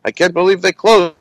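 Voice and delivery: retold disbelief, lilting and repetitive; Jackie Mason. Comic finding the place closed, imitated disbelief. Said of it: "He goes".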